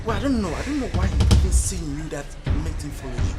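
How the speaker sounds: normal talking voice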